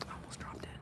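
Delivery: shaken hush after a near drop; whispered relief after almost dropping it; relieved whisper. Real, not acted. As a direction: gasp it out, relieved. Nearly dropped the figurine.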